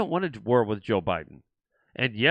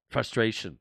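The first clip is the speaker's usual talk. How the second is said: usual speaking voice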